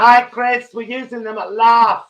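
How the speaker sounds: put-on high, loud camp American accent. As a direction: greet Chris like an excited American host. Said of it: "No American accent necessary".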